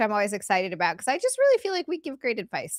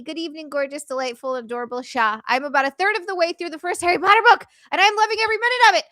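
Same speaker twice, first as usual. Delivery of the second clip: excited reading; 9 semitones higher, 4 dB louder, faster than usual. Real, not acted. Reads donor message excitedly.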